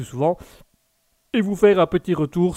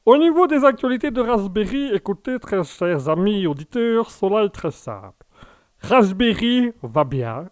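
new character. Acted. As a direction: present trivial updates as a grand, leisurely announcement. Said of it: "vous êtes un nouveau personnage".

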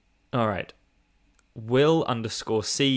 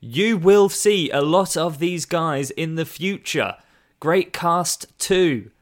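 loud, slow, much higher shouting read; all-caps emphasis; 8 semitones higher, 8 dB louder, slower than usual. Shown as a demonstration. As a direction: shout it like it's in all capitals. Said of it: "read with emphasis because it was all in capital letters".

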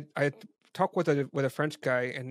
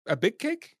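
incredulous; 8 semitones higher, 4 dB louder, slower than usual. Retold or imitated. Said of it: "It's just like".

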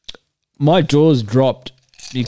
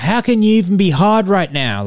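high, louder heckle from the crowd; mock heckling yell; 9 semitones higher, 4 dB louder than usual. Girl heckles performer from the crowd, mock yell. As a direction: call it out like a rowdy heckler. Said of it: "yelling out".

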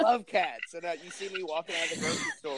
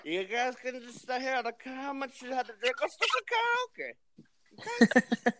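high, slurred tipsy woman voice; drunk wife impression; 8 semitones higher than usual. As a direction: play a tipsy wife begging for karaoke. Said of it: "my impression of my wife when she's been drinking".

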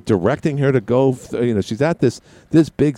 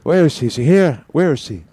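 mock Biden confusion; 4 semitones higher than usual. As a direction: call around the room, lost and bewildered. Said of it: "And he's like".

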